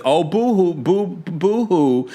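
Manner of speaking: slow, high mock crying; mock boo-hoo. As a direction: fake sobbing to mock him. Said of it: "Gene says".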